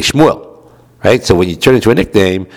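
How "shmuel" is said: Shmuel in one quick, higher slurred burst; said quickly. Shown as a demonstration. Teacher showing everyday speech, Shmuel said quickly and slurred. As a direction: run Shmuel together fast, the El barely there. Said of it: "But you say quickly".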